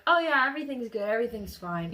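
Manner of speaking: loud, high-pitched brush-off; loud reassurance. Retold or imitated. Group assuring him everything is fine, loudly upbeat.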